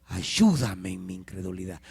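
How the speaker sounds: ordinary talk, nothing marked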